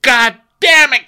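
loud, slow mock exasperation; exasperated outburst. Real, not acted. Host groaning about the cast, loud and slow. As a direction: blurt it out, fed up.

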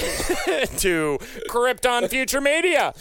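high, slow, drawn-out brand announcement; mock company jingle voice. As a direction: intone the brand like a hammed-up advert tagline. Mock corporate slogan for company.

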